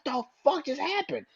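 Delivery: a pretend fan who can't believe it; stunned disbelief. Fans reacting to the betrayal, high stunned disbelief. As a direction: voice jumps up high in shock. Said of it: "You're gonna be like".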